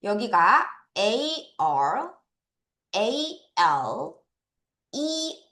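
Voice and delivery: ordinary talk, nothing marked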